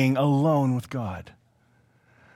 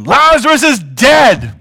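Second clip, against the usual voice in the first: loud, high, slow declaration; making it plain; 10 semitones higher, 10 dB louder, slower than usual. Acted. Jesus making it plain to disciples, blunt shout. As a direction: spell it out forcefully and slowly. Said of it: "Jesus made it plain to them".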